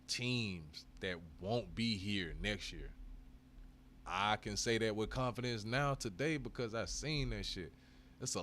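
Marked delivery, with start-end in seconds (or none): 0.1-2.9 slow, weighty delivery; slow emphatic. Real, not acted. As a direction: spell it out slowly.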